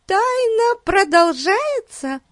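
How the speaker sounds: very high, slow, drawn-out question; high girl's voice. A narrator's reading. Betsy asking if the mystery continues, high girlish voice. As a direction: ask like a little girl, high and slow. Girl character asks about the mystery.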